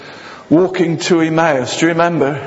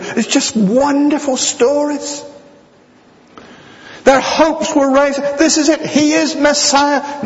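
preaching at full force; 11 semitones higher than usual. Acted. Preacher to congregation about lost vision, fervent preaching. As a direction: lift the congregation with it, ringing high.

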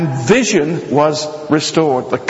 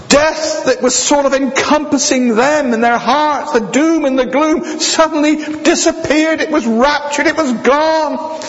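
preaching at full force; 10 semitones higher than usual. Acted. Preacher to congregation about lost vision, fervent preaching. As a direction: lift the congregation with it, ringing high.

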